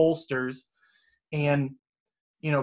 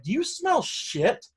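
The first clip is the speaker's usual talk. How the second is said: high, slow disbelieving question; incredulous high slow question; 13 semitones higher, slower than usual. Retold or imitated. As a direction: ask it in drawn-out disbelief, way up high. Asking boss about smell.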